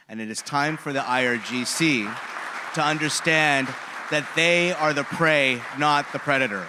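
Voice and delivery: loud, rising rallying cry; rousing shout. Real, not acted. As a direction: build to a ringing call to action. Rallying close to speech.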